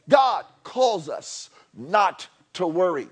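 usual speaking voice

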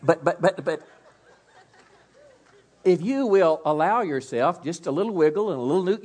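flustered stammer with a laugh; sheepish, laughing stammer; embarrassed laugh. Real, not acted. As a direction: stumble through it laughing. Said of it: "I'm embarrassed of myself".

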